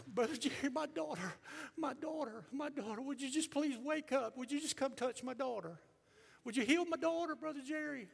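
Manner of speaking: high, loud, hammed-up begging; desperate parent pleading. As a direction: act out a frantic plea for your child. Voices a desperate parent begging for healing.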